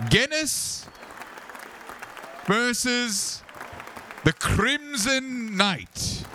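high, booming, drawn-out proclamation; arena announcer. Acted. Announcer addressing the arena after Blevin's win, grand high proclamation. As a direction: proclaim it high and slowly, stretching each name. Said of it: "one of the announcers comes out".

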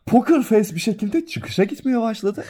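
giggling through the words; laughing while talking. Real, not acted. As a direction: laugh as you tell it. Laughing while telling anecdote.

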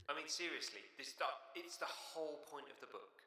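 high, very soft, incredulous aside; hushed exasperation. Real, not acted. Commenting on the baffling plot, hushed exasperation. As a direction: drop to a tiny high voice in disbelief. Exasperated rant drops to a squeak.

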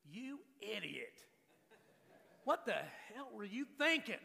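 father scolding son in mock anger. Shown as a demonstration. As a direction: smack him with the words. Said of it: "smack him with the truth".

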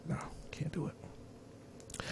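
slow, barely audible whisper; drawn-out refusal under the breath; resigned refusal. Real, not acted. Host ruling out raising a daughter, resigned whisper.

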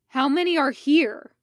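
loud, harsh demanding question. Acted. Fives demanding an answer from Obi-Wan, harsh and loud. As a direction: bark the question at him. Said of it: "Fives demanded, voice harsh".

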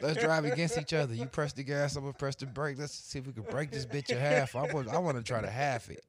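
high, loud, excited scheming; playful egging-on. Pretend. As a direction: egg your buddy on with a wild plan. Pitches wrecking the car together.

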